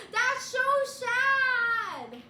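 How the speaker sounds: loud, high, drawn-out mock wail; mock lament. Streamer pitying a chatter's missing magpie call, mock wail. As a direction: wail it with exaggerated sorrow.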